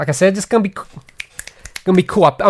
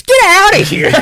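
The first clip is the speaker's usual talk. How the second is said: mock outraged shout; 6 dB louder than usual. Real, not acted. Friend's pick provokes mock outrage.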